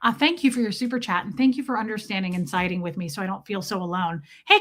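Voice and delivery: ordinary delivery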